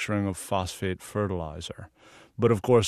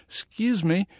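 put-on Southern accent; 8 semitones higher, 4 dB louder than usual. Photographer asking a plant guard for the bathroom, in a put-on Southern accent. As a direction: play up a friendly Southern accent. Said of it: "in my best Southern accent".

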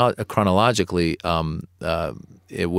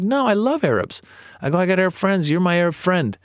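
much higher, over-eager denial; defensive protest; 10 semitones higher than usual. Retold or imitated. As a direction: scramble to prove you're not prejudiced.

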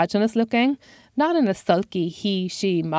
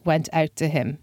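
usual speaking voice

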